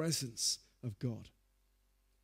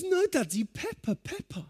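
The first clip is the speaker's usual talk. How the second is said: put-on high whiny child insisting; little son refusing a hug, high whining; child whine. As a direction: whine like a small boy glued to cartoons. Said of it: "He goes".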